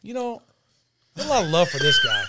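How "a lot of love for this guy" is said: high loud excited praise; excited shout. Real, not acted. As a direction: hype him up loudly. Hyped after pickle.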